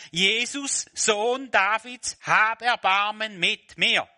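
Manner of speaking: loud, high, drawn-out plea; crying out. Acted. Blind beggar crying out to Jesus, loud and high. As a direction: call out to Jesus over the crowd, slowly. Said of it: "begann er zu schreien".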